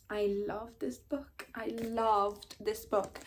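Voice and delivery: soft, drawn-out, savouring every word; slow heartfelt gush. Real, not acted. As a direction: say it slowly and softly, stretching LOVED. Gushing over a favourite book.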